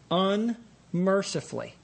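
usual speaking voice

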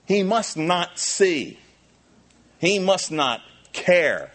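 high, loud, impassioned preaching; fiery sermon climax voice; full-force preaching. Acted. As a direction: preach it out, building to a cry.

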